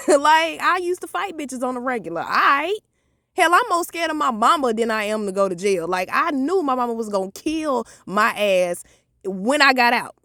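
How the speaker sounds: ordinary talk, nothing marked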